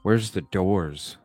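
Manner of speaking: ordinary talk, nothing marked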